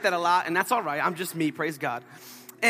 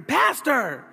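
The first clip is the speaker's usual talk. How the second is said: mock holler, pitched up; retold yell; 6 semitones higher, 4 dB louder than usual. Someone keeps calling the pastor, imitated high holler. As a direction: imitate a repeated call for attention. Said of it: "I just keep on hearing".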